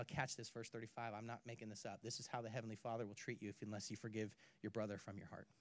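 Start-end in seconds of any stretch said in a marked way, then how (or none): none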